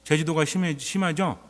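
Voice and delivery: ordinary talk, nothing marked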